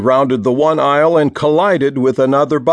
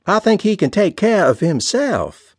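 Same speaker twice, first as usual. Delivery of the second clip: put-on teasing sister-in-law in a Mississippi accent; 7 semitones higher than usual. A narrator's reading. Callie prods Roxy about her crush, Mississippi accent. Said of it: "in her Mississippi accent".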